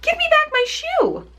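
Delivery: put-on high, indignant little girl demanding back her shoe; a girl's angry demand, pitched high; angry Dorothy. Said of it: "grew angry and said to the witch".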